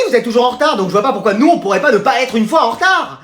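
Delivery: normal talking voice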